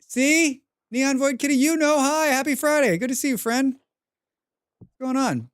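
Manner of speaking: high, bright-eyed hello to a viewer; cheerful high welcome to a regular; excited greeting. Real, not acted.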